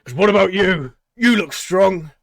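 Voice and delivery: high, loud hail; loud showman call. Acted. Tabaxi hailing the high elf, loud and high. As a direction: flatter her across the crowd.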